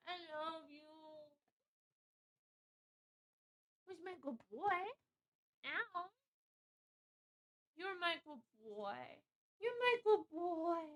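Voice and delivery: high, soft loving coo. Real, not acted. Streamer softly coos love to her dog, high and hushed. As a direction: murmur sweetly to the pup.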